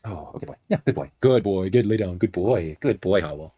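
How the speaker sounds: calm the dog as he lies down; soft dog praise. Real, not acted.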